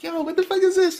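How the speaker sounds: high-pitched mock-incredulous outburst; acted bafflement. Reviewer retelling first reaction to the show, acted bafflement. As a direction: replay your shock in a high voice.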